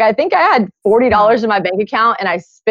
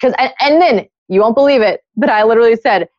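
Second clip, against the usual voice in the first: normal talking voice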